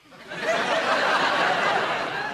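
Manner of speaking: a high, breathless wheeze of a laugh; high wheezing cackle. Real, not acted. [name] cackling at his own DSS joke, high wheezing laugh. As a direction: collapse into a wheezy cackle at your own gag.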